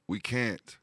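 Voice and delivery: high-pitched yell, held back in volume; narrator's contained yell to be heard above noise; yelling over din. A narrator's reading. Pittaway answering over the din of battle, yelling. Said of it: "yelled Pittaway above the din".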